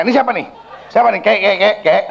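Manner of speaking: quick, high-pitched mock interrogation; nosy questioning. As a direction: pester the old spirit playfully. Imagined nosy questioning of grandfather spirit. (bertanya usil dengan cepat, nada tinggi)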